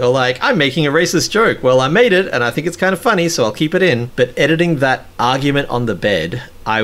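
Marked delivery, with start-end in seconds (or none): 0.4-4.1 higher-pitched, breezy self-imitation; mock carefree shrug. Host recalling how he judged his own jokes, mock carefree shrug. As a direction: imitate yourself brushing it off, pitched up.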